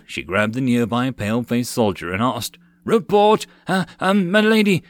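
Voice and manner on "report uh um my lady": high, loud, panicked stammer. Acted. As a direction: trip over the words in fear. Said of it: "the wild-eyed soldier stammered".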